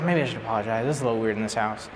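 low, soft aside to himself; reluctant inner thought. Retold or imitated. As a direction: think it aloud under your breath. Inner thought in awkward house.

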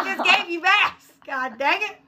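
high, exasperated retold whine; mock scolding. Mom to freshly bathed dogs, comic exasperation.

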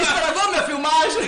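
high, loud, through laughter; hammed-up laughing protest; laughing mock complaint. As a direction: complain loudly while laughing. Mock complaint amid shared laughter.

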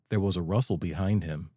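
ordinary delivery, nothing marked

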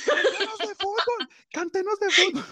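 high, squealing fan plea; excited fan shriek. Pretend. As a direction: beg like a screaming fan. Fan begging the singer guest.

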